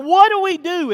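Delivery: loud, high-pitched acted wail; mock panicked whining. Preacher voicing Israelites hearing chariots come, mock panicked whining. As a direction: cry it out as a hammed-up complaint. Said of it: "they start whining and they start complaining".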